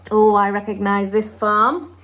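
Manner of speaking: ordinary delivery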